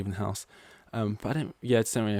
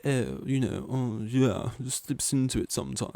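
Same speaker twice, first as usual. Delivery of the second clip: a touch of a posh Tory voice, mocking himself; 3 semitones higher than usual. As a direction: send up his own posh-sounding voice. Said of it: "that voice that just sounds really".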